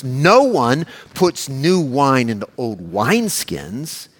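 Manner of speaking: slow, weighty stress on "no one"; emphatic no one. Retold or imitated. Preacher reading Jesus' words to his congregation, slowly and emphatically. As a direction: lean hard on "no" and let the line crawl. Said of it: "notice the emphatic nature of this".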